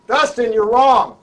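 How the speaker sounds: loud slow shout across the stage; retold shout. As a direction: call it out from the back, slow and loud. Spear carrier yells at star.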